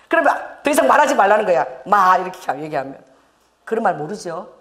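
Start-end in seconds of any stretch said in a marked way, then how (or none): none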